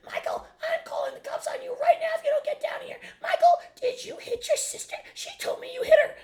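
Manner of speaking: very high, loud screeching imitation of his mother; mocking his nagging mother with a high, loud screech; screeching mom. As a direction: screech it like a barking chihuahua. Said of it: "she would be screeching in the background like a banshee".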